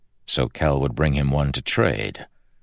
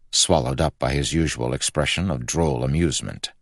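usual speaking voice

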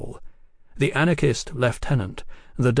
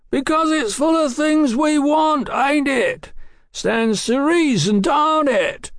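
high, raucous shouting; rough, high-pitched holler; rough shouted banter; 4 dB louder, slower than usual. A narrator's reading. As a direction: shout it up like a looter. Harsh banter shouted up the wall.

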